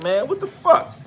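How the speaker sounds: high, baffled outcry; imitating stunned surprise; mock disbelief. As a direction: pitch jumps up in confusion. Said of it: "I'm like".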